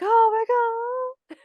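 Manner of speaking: very high, drawn-out mock gasp of horror; mock shock. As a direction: stretch it out like a stunned crowd. Imitates group reacting to reveal.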